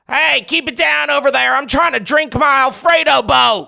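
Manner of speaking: high, hammed-up grumpy diner; cranky neighbor. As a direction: snap at the next table. Mock diner scolding newly engaged couple.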